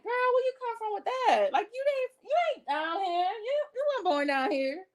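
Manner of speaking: high, sassy callout; sassy retort. Retold or imitated. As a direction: call her out, high and sassy. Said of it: "And you're like".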